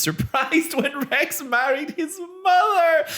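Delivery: high laughing squeal on the word; laughing shriek. Real, not acted.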